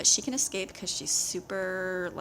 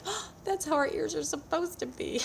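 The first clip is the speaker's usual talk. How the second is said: higher, mock-surprised put-on voice; amused realisation; 10 semitones higher than usual. Mom recalling a droopy-eared dog at the vet, in a higher, amused put-on voice. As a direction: play it as a goofy lightbulb moment. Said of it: "I was like".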